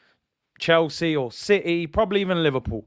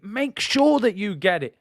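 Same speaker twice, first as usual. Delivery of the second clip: higher, hammering the word sure; emphatic demand; 5 semitones higher than usual. Real, not acted. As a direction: push it harder, pleading. Repeats the demand more strongly.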